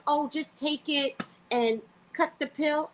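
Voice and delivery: slow, high mock clerk brush-off; a lazy, careless reply; dismissive clerk. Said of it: "it was something like".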